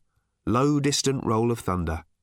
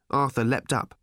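plain reading voice

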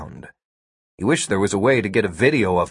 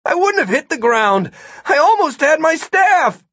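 high, heated argument; shouting back in frustration; indignant protest; 4 dB louder than usual. A narrator's reading. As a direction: protest it, climbing higher. Martin argues, shouting across the air.

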